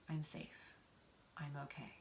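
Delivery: very soft, near-whispered reassurance; hushed self-reassurance. Acted. Voicing the sense that all is well, hushed and soothing. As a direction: breathe it out gently to yourself.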